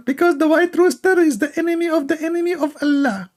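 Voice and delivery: squeaky, mocking uncle voice; high mock uncle voice. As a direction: pitch it up high like a naive old uncle answering. Mocking retold dialogue with uncle.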